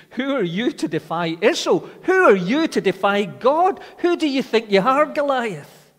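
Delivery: rising from loud to very loud, David's defiant cry at Goliath; righteous indignation. Acted. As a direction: hurl it at the giant in holy outrage. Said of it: "with something like righteous indignation".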